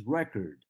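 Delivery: "record" said REC-ord, first syllable strong; stress on first syllable. Shown as a demonstration. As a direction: stress the start of "record", like the noun. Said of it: "I am stressing the first syllable".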